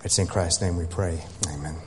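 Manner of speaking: soft, hushed prayer voice; hushed closing prayer. Real, not acted. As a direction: pray quietly and reverently. Closing prayer before sermon.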